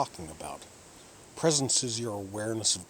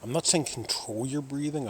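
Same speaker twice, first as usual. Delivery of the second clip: ordinary talk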